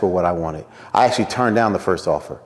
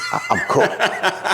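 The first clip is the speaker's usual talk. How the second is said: laughing; 10 semitones higher, slower than usual. Real, not acted.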